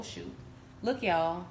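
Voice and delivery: ordinary talk, nothing marked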